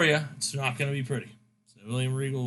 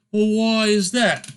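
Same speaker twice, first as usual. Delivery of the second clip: raised, slowed mock-indignant question; lightly voiced Regal, pitched up and dragged out; Regal's retort; 6 semitones higher, 5 dB louder, slower than usual. Regal objecting to Shane, in a mock-indignant voice.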